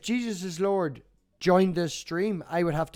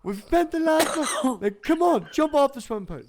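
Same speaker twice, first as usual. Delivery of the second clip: higher, louder exasperated coaxing; exasperated urging; 10 semitones higher, 8 dB louder than usual. Retold or imitated. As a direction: plead with the stubborn toddler to jump. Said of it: "I'm like".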